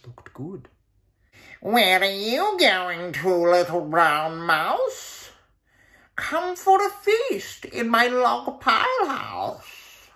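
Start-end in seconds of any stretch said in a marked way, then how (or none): 1.6-10.1 slow, stretched-out snake drawl; hissing snake voice. Acted. Snake invites the mouse to a feast, slow. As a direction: draw every word out like a slithering snake.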